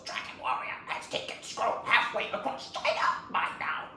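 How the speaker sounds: strained, mostly voiceless rasp; halting, effortful defiance; Shifu. Acted. Wounded master taunts villain about the scroll, strained defiance. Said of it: "Shifu goes".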